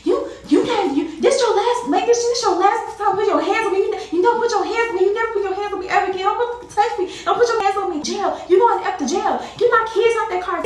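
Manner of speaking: high, loud, furious yelling. Retold or imitated. Woman yelling at her attacker to get her kids, high and loud. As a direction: scream at him to never touch you again. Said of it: "I'm like".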